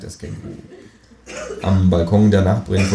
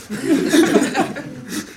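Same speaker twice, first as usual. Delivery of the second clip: high, odd cackling laugh; kangaroo cackle. Acted. Kangaroo laughs at the narrator dangling from the rope, high cackle.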